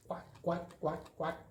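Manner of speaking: high, breathy duck quacks for the card; duck quacking. Pretend. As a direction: play the duck and quack at the kids. Duck pop-up card moves.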